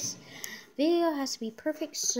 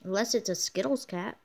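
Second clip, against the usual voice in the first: ordinary talk, nothing marked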